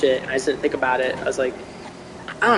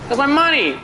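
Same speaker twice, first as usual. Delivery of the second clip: high, pushy mock gangster demand; mock mobster demanding his money back; Sopranos mobster impression; 10 semitones higher than usual. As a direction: shake him down like a mob heavy, hammed up. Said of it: "like that beginning scene from The Sopranos".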